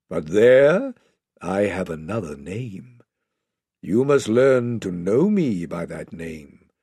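low, stately lion voice; Aslan, solemn teacher. Acted. Lion explaining his other name to the children, solemn. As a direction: instruct them gravely, deep and deliberate.

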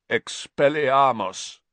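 slow, rasping croak of a spell. Acted. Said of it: "he croaked".

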